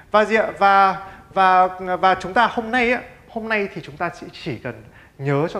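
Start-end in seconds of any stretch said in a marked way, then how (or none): none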